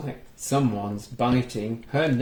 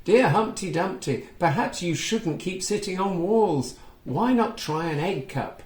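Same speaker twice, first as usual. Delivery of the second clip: put-on reading to children; 5 semitones higher than usual. A narrator's reading. Reading a picture book aloud to young children, lively storytelling. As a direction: bring the story alive for little listeners. Reads Mother Goose's reply.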